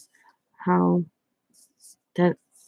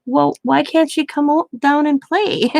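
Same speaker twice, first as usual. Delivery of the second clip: put-on high-pitched child's protest; high, questioning kid voice; Riley; 8 semitones higher, 3 dB louder than usual. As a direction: imitate a small child pressing for an answer. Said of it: "She keeps asking me".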